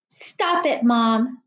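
plain reading voice

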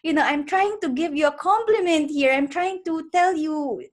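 loud, high, exasperated scolding; retelling her supervisor's annoyed scolding, loud and high. Retold or imitated. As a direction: scold the stubborn student, half playful. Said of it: "he would be so annoyed with me".

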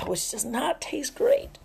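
a slow breathy whisper aside. Real, not acted. Sipping beer, telling listeners in a whisper aside. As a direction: drop to a whisper, sharing a secret.

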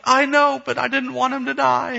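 hammed-up childish wail; mock crying child. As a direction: protest like a tearful little kid. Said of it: "she starts crying uncontrollably".

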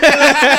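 sudden loud laugh. Real, not acted. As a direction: burst out laughing. Burst of laughter.

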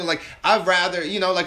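ordinary delivery

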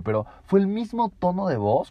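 ordinary talk

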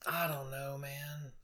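whispered and trailing off; whispering. Real, not acted. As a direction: breathe it out unsure. Unsure, drops to whisper.

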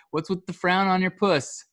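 lightly voiced grandma asking; imitating grandma. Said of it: "She'd always be like".

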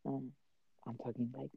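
soft, held-down voice; quiet but clear. Real, not acted. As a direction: keep it low so family won't overhear. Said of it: "I'm talking a little quiet".

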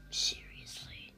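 slow, hushed, grave whisper; extra serious. Acted. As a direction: say it as if it's deadly serious. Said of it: "she says it even more seriously".

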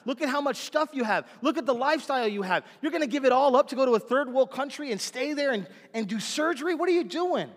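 high, loud, urgent begging; pleading partner. Retold or imitated. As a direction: beg him not to throw it away. Partner begging doctor to stay.

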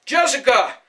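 a loud, high desperate call; calling after daughter. A narrator's reading. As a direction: call her name across the street. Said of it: "Hannibal called out".

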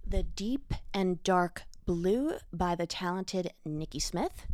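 normal talking voice

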